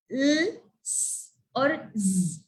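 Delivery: "l", "s" and "z" each held as a pure sound; isolated letter sounds. Shown as a demonstration. Teacher listing sounds the child doubles, each sounded out. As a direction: sustain "l", hiss "s", buzz "z". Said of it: "hears either of these sound".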